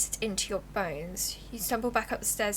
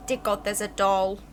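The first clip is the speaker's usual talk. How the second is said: a louder, horrified repeat; 5 dB louder than usual. Real, not acted. Player recoiling from the doll in the closet, horrified.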